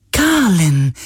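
high, joyful spirit cry; light up with delighted recognition; suddenly joyful. A narrator's reading. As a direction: glad otherworldly call. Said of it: "sagte der Geist plötzlich freudig".

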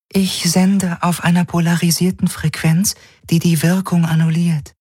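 beautiful, high, even voice; an artificial mind reporting in high, level tones; Helix. Acted. The Helix whispers its answer in his head, beautiful even voice. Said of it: "sagte ihre schöne Stimme".

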